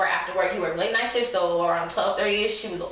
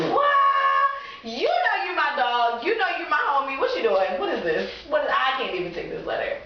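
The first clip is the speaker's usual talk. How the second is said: amused disbelief; 6 semitones higher than usual. Retold or imitated. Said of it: "busted out laughing".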